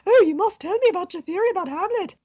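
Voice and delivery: high-pitched, gushing mock Englishman; eager Englishman. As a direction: play an over-keen English guest in falsetto. Voices eager Englishman Haynes in retelling.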